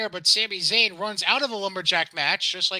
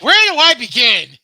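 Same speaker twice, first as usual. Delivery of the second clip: higher, louder, slow overwhelmed sigh; mock despair at a pile of gripes; mock exasperation; 7 semitones higher, 13 dB louder than usual. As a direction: ham up not knowing where to start. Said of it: "you were probably like".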